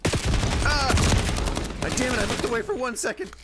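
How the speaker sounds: high, fast frustrated outburst; high-pitched flustered cursing; exasperated yelp. Real, not acted. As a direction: cry out, then rush the complaint. Caught off guard in game.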